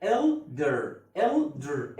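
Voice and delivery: elder said slowly and carefully, sound by sound. Shown as a demonstration. Teacher calmly drilling elder for students. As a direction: take elder one step at a time. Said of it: "بالراحه، واحده واحده".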